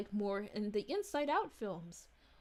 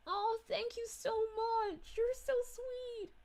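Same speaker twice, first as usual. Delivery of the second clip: touched thanks; 8 semitones higher, slower than usual. Real, not acted. Thanking a viewer for a compliment.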